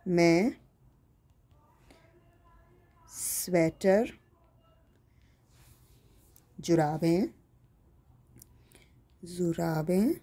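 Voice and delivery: very slow, word by word with long pauses; writing-along dictation. Real, not acted. Teacher writing the answer for pupils, slow halting dictation. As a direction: dictate as you write, each piece drawn out. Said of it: "तो इसलिए मैं अच्छा लिखूँगी".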